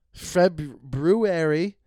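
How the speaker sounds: February stretched out slowly, every syllable spelled out; careful pronunciation. Shown as a demonstration. Checking how to say it, slow careful February. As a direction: say February very slowly, fe-bru-ary. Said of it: "How do you say s-, February?".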